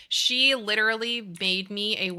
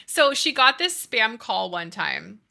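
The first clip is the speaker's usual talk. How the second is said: ordinary delivery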